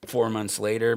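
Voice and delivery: ordinary talk, nothing marked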